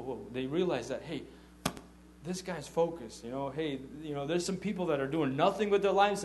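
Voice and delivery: ordinary delivery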